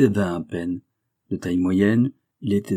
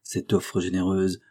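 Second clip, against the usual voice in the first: ordinary delivery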